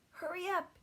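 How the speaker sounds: put-on high, loud, impatient boy; eager urging. A narrator's reading. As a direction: rush his brother out of bed. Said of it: "could not wait".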